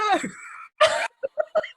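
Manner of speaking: high, cracking burst of laughter; squealing laugh of embarrassment; embarrassed laugh. Real, not acted. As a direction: laugh helplessly at yourself. Laughs at her own gaffe.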